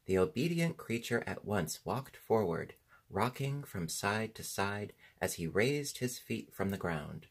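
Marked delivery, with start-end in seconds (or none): none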